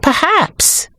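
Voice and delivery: high, hesitant suggestion; unsure, high and tentative; uncertain. A narrator's reading. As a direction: venture it tentatively, pitched high. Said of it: "she said uncertainly".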